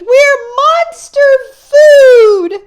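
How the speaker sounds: very high, loud, slow stretched scream; terrified scream. Acted. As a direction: wail that they're about to be eaten. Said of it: "Murphy screamed".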